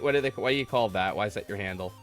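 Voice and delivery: ordinary talk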